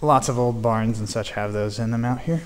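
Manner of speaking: ordinary delivery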